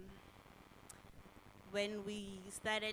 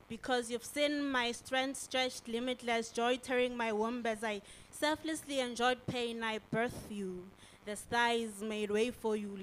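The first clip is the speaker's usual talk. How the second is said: louder, driving slam poetry; impassioned spoken word; 2 semitones higher, 10 dB louder than usual. Acted. Poet reciting We Are Revolution, loud impassioned verse. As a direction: declaim it with force and pride. Performs second slam poem.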